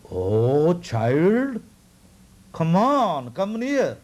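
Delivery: put-on a touch of a sweet, lifted baby-god voice; divine baby calling. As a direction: call him gently nearer. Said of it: "began speaking so clear, like the rumbling of a cloud".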